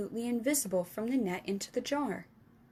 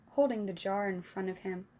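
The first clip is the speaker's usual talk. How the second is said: ordinary delivery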